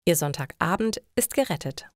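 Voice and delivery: usual speaking voice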